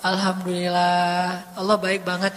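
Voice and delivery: low, slow, drawn-out gratitude; acted after-meal contentment; contented thanks. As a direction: thank God with lazy satisfaction. Acts contented thanks after eating.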